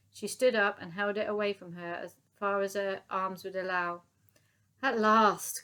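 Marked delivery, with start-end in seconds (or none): 4.8-5.6 louder call. A narrator's reading. Sergeant major across the courtyard, calling out. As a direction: call out with relief. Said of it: "called out the sergeant major".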